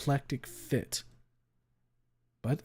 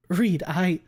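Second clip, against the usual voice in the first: higher, louder startled plea; frightened stammer; 7 semitones higher, 7 dB louder than usual. A narrator's reading. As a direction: stammer to Reed in fear. Trish stumbling, scared.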